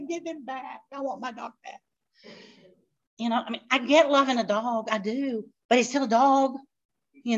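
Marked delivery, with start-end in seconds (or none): 0.0-1.8 high, then a breaking, whimpering mock cry; fake-sob over the lost dog; a hammed-up tearful plea. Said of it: "And I was like".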